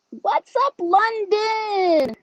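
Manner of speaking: high, bright call-out to a viewer; excited greeting. Real, not acted. Greeting viewer London from the live chat, high excited call. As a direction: sing out a hello to the chat.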